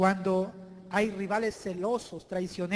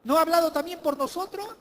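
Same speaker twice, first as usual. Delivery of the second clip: louder, higher, protesting questions; indignant challenge; 7 semitones higher, 6 dB louder than usual. Acted. Preacher voicing Miriam's jealous protest, loud. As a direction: Miriam demanding her own share, loud and higher. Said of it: "Esta mujer decía".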